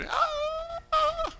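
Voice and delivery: a sudden high mock wail of an emotional worshipper. Preacher mimicking a weeping congregation, mock wail. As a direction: wail it out like someone overcome.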